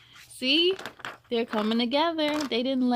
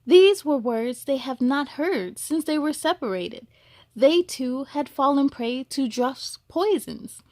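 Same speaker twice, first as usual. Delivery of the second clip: gentle storybook reading; storyteller voice for children; story narration; 3 semitones higher, 4 dB louder than usual. A narrator's reading. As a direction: read it like a bedtime story.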